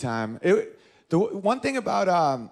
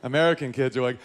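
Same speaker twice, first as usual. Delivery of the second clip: usual speaking voice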